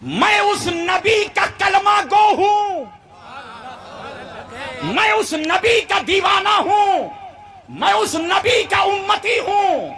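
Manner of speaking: high, drawn-out preaching shout; ringing, impassioned pulpit cry; full-force declaration. Acted. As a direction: proclaim devotion to the whole crowd.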